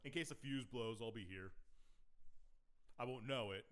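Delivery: loud, high hammed-up NPC; mock NPC voice. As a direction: play the clueless helper loudly. Mocking the NPC staying behind.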